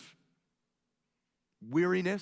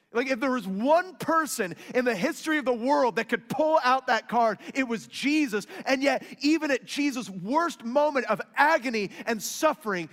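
loud, high-pitched preaching climax; preaching at full force; 11 semitones higher, 9 dB louder than usual. Real, not acted.

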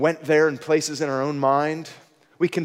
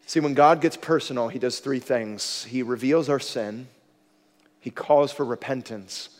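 normal talking voice

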